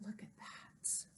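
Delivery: hushed gasping wonder; awed whisper. Real, not acted. Librarian points at the picture for children, awed whisper. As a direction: whisper it in amazement.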